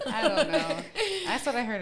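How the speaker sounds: real laughter in the words; laughing. Real, not acted. Storyteller defending the imagined Christmas rap, laughing. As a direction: answer through giggles.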